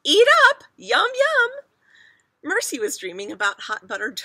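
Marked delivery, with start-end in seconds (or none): 0.0-1.5 very high, loud urging; doting mother. A narrator's reading. Mother urges Mercy to eat in a dream, high doting voice. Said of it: "Missus Watson said in her sleep".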